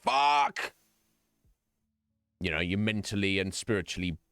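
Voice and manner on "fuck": loud, higher burst of a swear; sudden swear. Retold or imitated. Hearing about another surgery, a sharp shouted curse. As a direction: blurt it out like hearing bad news. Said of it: "you hear about it and you go".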